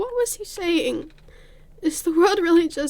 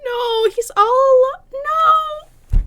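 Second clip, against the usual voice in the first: loud, high, tearful no; real crying protest; 8 semitones higher, 7 dB louder, much slower than usual. Real, not acted. Streamer watching a character left alone, crying out. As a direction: cry out, voice breaking. Streamer reacting to game scene.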